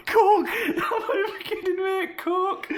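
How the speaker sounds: squealing through laughter; high, breaking up laughing; laughing hard. Real, not acted. Laughing at the spelling.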